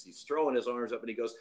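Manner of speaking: normal talking voice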